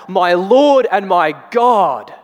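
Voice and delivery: slow, high, loud cry of faith; cries out. Retold or imitated. Thomas to Jesus, awed slow loud cry. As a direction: cry it out slowly in awe. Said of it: "He cries out".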